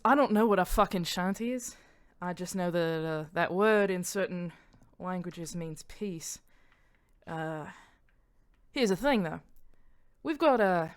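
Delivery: frank, sweary Australian character; Australian accent. Acted. Mania levelling with Bara about shanties, Australian accent. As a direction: talk like a straight-talking Australian. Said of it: "that she turned Australian".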